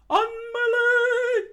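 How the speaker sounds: very high, loud, slow scream. Acted. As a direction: shriek the line out. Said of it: "Sanji screamed out".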